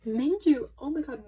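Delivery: sharp gasp then loud delighted cry; gasp, then shout the name. Real, not acted. Fan pulling her second bias's card, shouting with joy.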